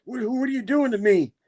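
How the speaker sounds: high-pitched mock bewilderment; distressed victim. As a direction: plead in a squeaky panicked voice. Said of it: "And Norman's like".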